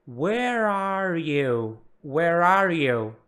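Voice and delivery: "Where are you" slowly glued, the r running into "are"; linked words. Shown as a demonstration. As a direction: join "Where are you" into one drawn-out "whe-rar-you". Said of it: "presta atenção como a gente gruda aqui". ("Where are you" devagar, com as palavras grudadas)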